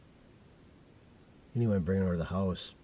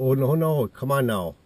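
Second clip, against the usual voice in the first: loud, higher-pitched protest; dad's objection; 6 semitones higher, 8 dB louder than usual. Retold or imitated. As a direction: cut in as a disapproving dad. Imagines dad objecting.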